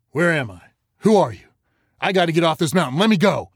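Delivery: high, loud, fast panicked cries; a terrified boy begging to be let go; panicked boy struggling against his rescuers, shouting. Acted. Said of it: "The boy was panicked, nearing hysterics".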